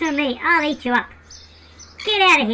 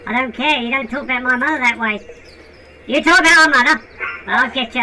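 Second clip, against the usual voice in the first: low, menacing voice; low tough voice; 11 semitones lower, 3 dB louder than usual. Pretend. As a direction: threaten low and hard. Low brother threatens.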